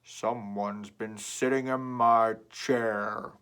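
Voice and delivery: loud, very slow booming papa bear; deep Papa Bear. Acted. Papa Bear finding his chair sat in, loud and slow. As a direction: drag it out, stressing my. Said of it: "and said".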